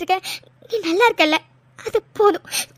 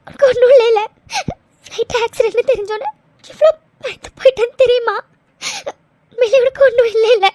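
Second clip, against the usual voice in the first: crying. Acted. Girl begging her fiancé for reassurance, crying. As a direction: sob out the question. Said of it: "அழாதடி".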